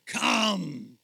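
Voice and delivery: a booming thundering call; a creature's thunderous summons, loud. Acted. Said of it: "say in a loud voice like thunder".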